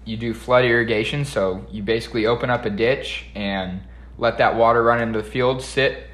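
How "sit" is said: ordinary talk, nothing marked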